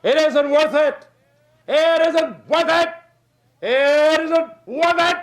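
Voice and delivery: high, slow, whiny drawn-out complaint; mock the moaning quitters, high and slow; whining holler. Preacher mocking whiny quitters, high and drawn out. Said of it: "they just hang around and holler".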